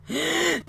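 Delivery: high sudden intake of breath; sharp gasp. Acted. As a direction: gasp in shock. Junpei reacts to Seven's claim.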